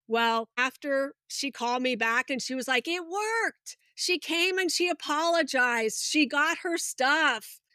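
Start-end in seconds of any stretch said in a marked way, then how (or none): 2.9-7.6 higher, delighted report; light mock thrill of success; excited retelling. Friend calling back to report success, excited.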